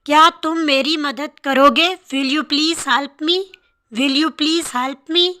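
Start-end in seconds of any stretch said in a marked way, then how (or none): none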